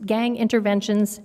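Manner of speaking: usual speaking voice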